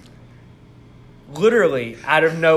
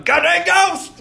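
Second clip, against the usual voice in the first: mock shout; 13 semitones higher, 4 dB louder, slower than usual. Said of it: "and I've gone".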